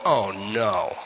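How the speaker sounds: low, drawn-out mock dismay; dismayed groan. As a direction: sink the voice in comic dread. Listener groaning again.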